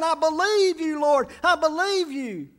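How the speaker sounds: high, loud "I believe you, Lord"; preacher voicing the healed man's excited worship; overjoyed confession. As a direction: cry it out, unable to contain the joy. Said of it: "so excited he couldn't contain himself".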